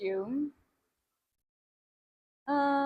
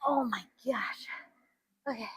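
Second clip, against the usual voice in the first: breathless whisper from the burn; 3 semitones lower, 9 dB quieter than usual. Real, not acted. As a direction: gasp it out under your breath. Burning abs mid-workout.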